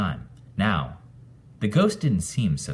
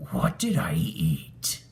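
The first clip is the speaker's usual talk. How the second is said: ghost whisper; slower than usual. Acted. Sick ghost after eating.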